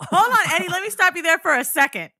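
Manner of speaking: high, loud cut-in; loud interruption. Real, not acted. Stopping her cohost Eddie, a loud cut-in. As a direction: cut him off emphatically.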